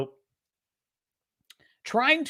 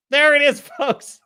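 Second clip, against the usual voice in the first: excited reveal; 9 semitones higher, 7 dB louder than usual. Real, not acted. Revealing the thumbnail.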